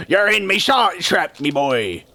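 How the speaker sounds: lightly voiced pirate accent; pirate Krabs. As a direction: growl it like an old sea dog. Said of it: "He's like".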